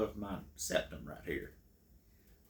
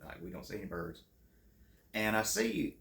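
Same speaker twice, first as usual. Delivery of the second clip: ordinary talk, nothing marked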